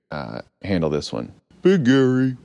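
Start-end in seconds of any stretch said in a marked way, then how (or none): 1.6-2.4 higher-pitched put-on creature voice; Gary's character voice. Gary takes on the heavy bone, silly creature voice. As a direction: answer with your name in a silly raised voice. Gary answers with his own name.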